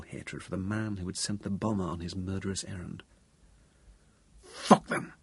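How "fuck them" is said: explosive, rough-edged curse; loud, furious outburst. A narrator's reading. Bereaved man cursing the bombers before his friend, furious. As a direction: burst out with sudden hatred. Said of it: "so loudly that it made John start".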